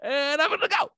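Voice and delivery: very high, thin "I'm gonna go"; squeaky callback. Pretend. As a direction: toss the line back as a cartoon squeak. Comic callback of the exit line.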